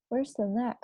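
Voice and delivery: plain reading voice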